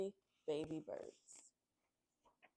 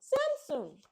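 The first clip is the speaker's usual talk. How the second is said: high, loud shout of a name; calling out; 8 dB louder than usual. Acted. Baby birds shouting for Samson, high loud call. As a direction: call her name with all your breath. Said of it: "calling out".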